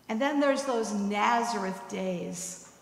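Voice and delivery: ordinary talk, nothing marked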